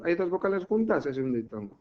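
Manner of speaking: ordinary talk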